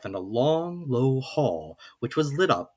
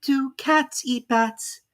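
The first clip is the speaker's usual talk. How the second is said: put-on slow, drowsy higher girl's voice; sleepy Alice; 11 semitones higher, slower than usual. A narrator's reading. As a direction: let it drift as she dozes off. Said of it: "began to get rather sleepy".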